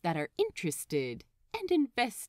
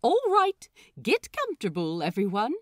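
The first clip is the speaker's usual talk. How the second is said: gather the crew like a showman; dramatic announcement; 2 semitones higher, 7 dB louder, faster than usual. A narrator's reading. Captain starting story time, grand and theatrical. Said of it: "dramatically clears his throat".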